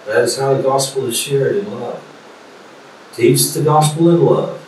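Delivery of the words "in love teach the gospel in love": ordinary talk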